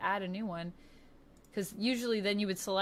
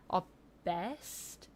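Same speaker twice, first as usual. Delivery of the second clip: put-on trying Lucy's accent; 4 dB quieter than usual. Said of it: "trying to sound like Lucy".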